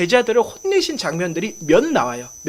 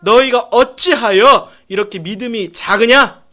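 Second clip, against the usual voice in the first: loud, raised preacher's scolding; forceful rebuke; 3 semitones higher, 6 dB louder than usual. Acted. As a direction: rebuke the faithless, pressing each phrase. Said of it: "제자들을 혼내신 겁니다". (목소리 높여 꾸짖는 설교)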